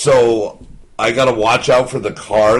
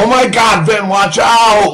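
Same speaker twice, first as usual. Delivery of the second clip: imitating the wife yelling at the gas station; mock freak-out shout; 8 semitones higher, 5 dB louder than usual. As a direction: shout it like a panicking passenger. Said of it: "like, freaks out".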